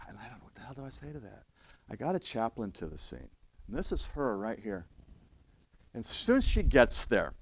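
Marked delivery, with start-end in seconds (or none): none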